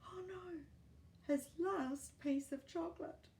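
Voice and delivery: plain reading voice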